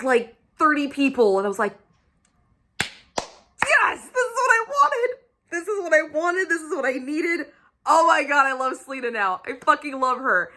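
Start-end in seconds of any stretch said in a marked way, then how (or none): 2.8-7.5 very high, squeaky fangirl cry; re-enacted elation, pitched way up; ecstatic squeal. Re-enacts ecstatic reaction to book. 7.9-10.5 high, excited fan gush; gushing adoration. Real, not acted. Gushing over the heroine.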